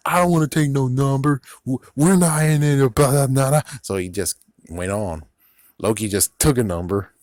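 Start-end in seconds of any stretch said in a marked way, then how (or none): 0.1-3.6 higher, louder jerk voice brushing it off; obnoxious jerk. Retold or imitated. Mocking the rude guy in line, higher obnoxious voice. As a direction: play the rude guy refusing a number. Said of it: "complete jerk ass who's like".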